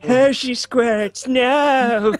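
person on the toilet. Acted. Imagined toilet sitter dreading what comes, slow mock dismay. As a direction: stretch it out like a mild toilet lament. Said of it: "somebody on the toilet saying that".

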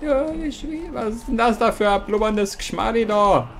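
high put-on southern German dialect voice; mixed mock dialect. Gamer mocks a bubbling obstacle in a mixed dialect, high. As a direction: squeak it out in a jokey dialect. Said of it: "Jetzt mische ich auch noch Akzente miteinander".

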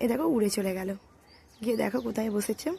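normal talking voice

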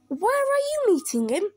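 high anxious question; alarmed owl. Acted. Owl asks mouse about the monster, alarmed.

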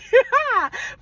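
a high, loud laugh at her own joke; bright laughing burst; high burst of laughter. Real, not acted. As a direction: laugh out at the punchline.

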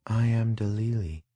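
soft, drowsy, toneless murmur; somnolent toneless voice. A narrator's reading. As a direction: speak half asleep, without any tone. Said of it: "answered somnolently in a toneless, indistinct voice".

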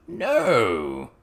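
loud frog-like goblin voice; loud, drawn-out froggy protest; croaking goblin. A narrator's reading. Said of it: "in his frog's croak of a voice".

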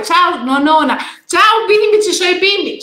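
high, loud delighted greeting; excited hello. Real, not acted. Grandmother greeting her grandchildren who just came in, high and loud.

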